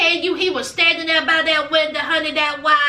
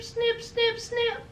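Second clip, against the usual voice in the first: high, soft teasing snip snip; light, high, hushed snips; playful snip; 5 semitones higher, 9 dB quieter, slower than usual. Retold or imitated. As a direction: mime the little cut with the voice. Acting out the snip.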